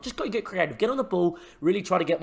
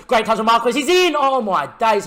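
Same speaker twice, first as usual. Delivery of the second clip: rising, much louder and higher as he breaks through; excited shout; 8 semitones higher, 10 dB louder than usual. Real, not acted. Marquez breaks clear and the manager shouts with excitement. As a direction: scream him through on goal.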